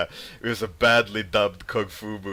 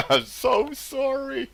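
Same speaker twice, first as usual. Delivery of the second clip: much higher, slow squeaky sorry; mock apology; 5 dB louder, slower than usual. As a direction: play the guilty latecomer in a tiny voice. Playing the late viewer apologizing.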